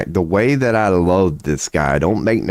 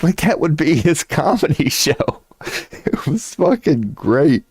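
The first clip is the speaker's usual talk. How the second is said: laughing; 10 semitones higher than usual. Real, not acted. Laughing about Bernie Mac's act.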